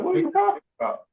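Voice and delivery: quick, high-pitched mock disbelief; incredulous retort. Baffled reply to brother about Southern pride, acted incredulous.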